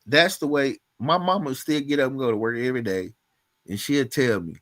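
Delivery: ordinary talk, nothing marked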